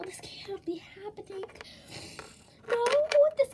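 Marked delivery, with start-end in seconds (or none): 0.0-1.4 panicked whisper. Acted. Hiding in panic.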